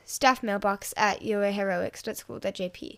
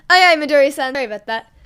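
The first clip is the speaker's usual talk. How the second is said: loud apology; 3 semitones higher, 12 dB louder than usual. A narrator's reading. Students apologize loudly.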